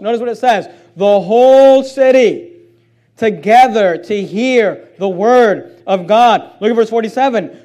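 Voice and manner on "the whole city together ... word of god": slow, louder Bible reading; scripture reading. Acted. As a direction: read each word slowly to the congregation.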